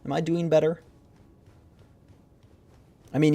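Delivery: normal talking voice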